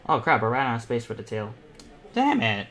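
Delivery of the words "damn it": sharp, high burst of annoyance; real irritation at a drawing mistake; frustrated outburst. Real, not acted. Artist venting at running out of canvas space, frustrated.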